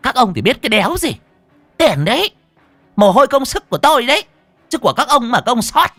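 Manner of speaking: high, loud, fast protest; indignant retort. A narrator's reading. Stall owner answering onlookers who challenged her, indignant. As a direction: shout back in outraged self-defence.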